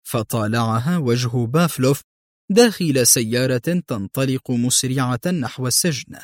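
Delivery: plain reading voice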